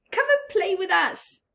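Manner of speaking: high, loud playful call; calling fish. Acted. Other fish calling Rainbow Fish, high playful call. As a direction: call him over to play. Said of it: "they would call".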